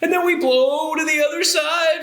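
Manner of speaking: sway high like a windblown tree; playful high-pitched swoop; blown by wind. Pretend. Acts wind pushing the trees back.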